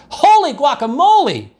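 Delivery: high startled kitten cry; surprised exclamation. A narrator's reading. Kitten spotting himself in the mirror, high surprised cry. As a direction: yelp it in delighted shock. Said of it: "exclaimed Skippy John Jones".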